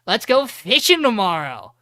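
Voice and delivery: excited invitation. Retold or imitated. Calls buddy to go fishing.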